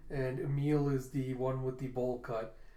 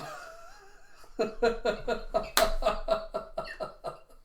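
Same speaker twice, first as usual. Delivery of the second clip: real laughter; 8 semitones higher than usual. Real, not acted. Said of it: "that's why I laughed too hard".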